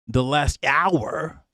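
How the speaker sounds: very slow, drawn-out mock Yankee "hour"; mock Yankee accent. Streamer mocks the reporter again, very slow Yankee accent. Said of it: "that Yankee accent is mad".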